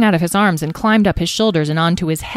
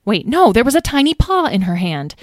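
usual speaking voice

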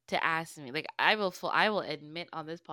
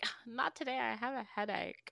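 put-on a touch higher and softer, girl squirrel begging off; lightly voiced girl squirrel; 6 semitones higher, 5 dB quieter than usual. Girl squirrel turning down her mate, lightly voiced and soft. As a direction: start high and soft, then ease down. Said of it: "like, the girl squirrel's like".

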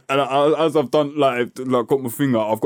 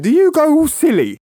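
high, louder mock-innocent question; imitating coworker; 14 semitones higher, 5 dB louder than usual. Coworker Pete asks about hypos, high mock voice. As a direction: mimic a clueless coworker, pitched way up. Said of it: "He went".

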